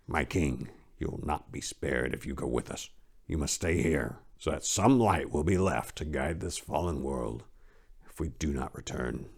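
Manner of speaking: soft, earnest warning; earnest plea. Acted. Daniel urging King Cyrus to stay behind, soft and earnest. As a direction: quietly beg him to stay.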